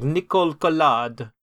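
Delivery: name said with a French accent. Real, not acted. Answering who the favourite character is, French accent. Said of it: "say her name like that".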